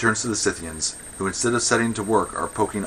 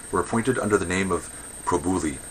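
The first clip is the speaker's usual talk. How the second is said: usual speaking voice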